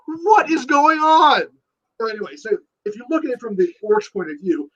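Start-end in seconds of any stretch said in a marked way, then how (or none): 0.1-1.5 much higher and slow, hammed-up confused orc; bewildered orc. Orcs as the earthquake wipes them out, mock bewilderment. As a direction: drag it out like a baffled grunt. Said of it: "The orcs are like".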